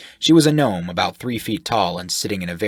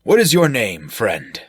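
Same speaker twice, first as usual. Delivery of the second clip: loud, higher, hearty; jovial sailor-type voice; 4 semitones higher, 7 dB louder than usual. Acted. Shirk flatters the sailor, loud and jovial. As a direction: boom it out like a jolly brute.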